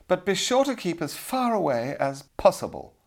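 high, disdainful lady's voice; haughty damsel. A narrator's reading. Damsel keeps the kitchen youth away, haughty and high. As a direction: order him to keep off, pitched high.

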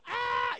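put-on short high, loud kid scream; mock scream. Eden still screaming amid the fight, short mock scream. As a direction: imitate a child standing there screaming. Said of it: "Eden's still screaming".

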